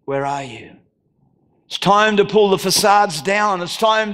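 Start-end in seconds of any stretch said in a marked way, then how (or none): 0.0-0.8 God's hushed call. Pretend. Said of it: "as he would have said".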